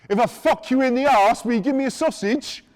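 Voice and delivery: high, very loud blurt; loud retold question. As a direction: belt out the question for all to hear. Retelling the man's loud outburst.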